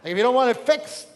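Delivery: loud, high exasperated husband; hammed-up shout to be left alone; mock angry outburst. Acts out his angry inner reply.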